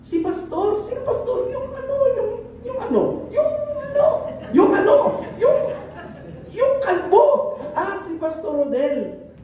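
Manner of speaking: high chatty voice groping for a name; high-pitched mock gossip, stalling on yung ano; mock congregant gossip. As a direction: play a forgetful churchgoer hunting for the word. Congregant voices asking who.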